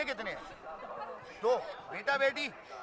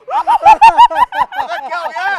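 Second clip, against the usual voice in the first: much higher, much louder, laughing cries; shocked shout; 14 dB louder, much slower than usual. Real, not acted. Host yells laughing at contestant's wild laugh. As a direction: yell in amused disbelief.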